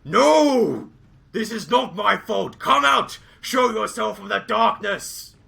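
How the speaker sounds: loud, high indignant yelling from the dark; defensive shout. Acted. Hidden stranger shouts at the accuser, loud and indignant. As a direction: bellow a denial and a challenge into the darkness. Stranger yells back from darkness.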